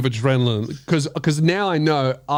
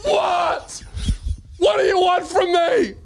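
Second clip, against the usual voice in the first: high, strained mock shouting; retold frantic yelling, pitched way up; hammed-up outburst; 13 semitones higher than usual. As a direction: cry out as if driven mad by a voice. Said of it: "she's hearing me go".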